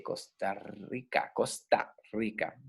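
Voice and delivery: "Costa Rica" slowly, t against the teeth; dental T. Shown as a demonstration. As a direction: softly sound out "Costa Rica" with a Spanish dental t. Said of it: "no en los dientes, como la T".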